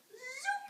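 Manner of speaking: high rising zoop; magic wand zoop. Acted. Father waving his magic wand, high zoop. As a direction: swoop the sound sharply upward. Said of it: "and he'd go".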